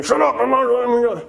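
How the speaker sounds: pushing away. Retold or imitated. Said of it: "not pushing it away, saying".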